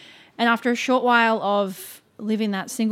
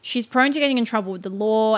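ordinary talk

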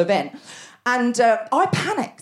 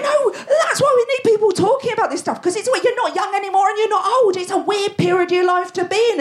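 much higher, urgent; impassioned; 12 semitones higher than usual. Real, not acted. Pleading for people to talk about menopause, impassioned. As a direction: push the point passionately.

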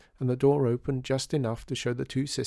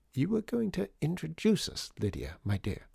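take-charge sister; 7 semitones higher than usual. A narrator's reading. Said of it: "usually the one to take charge".

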